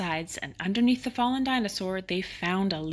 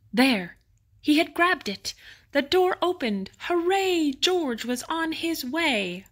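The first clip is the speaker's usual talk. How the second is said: excited cheer; 7 semitones higher, slower than usual. A narrator's reading. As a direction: cheer him on. Narrator cheers George's escape.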